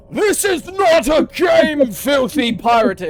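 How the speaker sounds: slow, high, thundering rebuke; outraged wizard shout. Acted. Wizard scolding a pirate who treats battle as play, outraged shout. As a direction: bellow it slowly in outrage. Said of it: "as the wizard goes".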